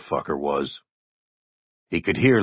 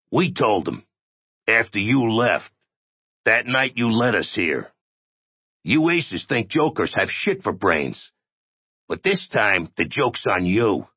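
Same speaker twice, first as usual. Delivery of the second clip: higher-pitched, lightly voiced accusing creature; resentful oddity; 5 semitones higher than usual. The oddity tells the captive the joke's on him, resentful. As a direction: accuse them in a raised character voice.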